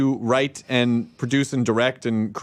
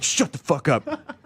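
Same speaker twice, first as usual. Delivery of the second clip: mock bad cop; slower than usual. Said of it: "I have to play bad cop".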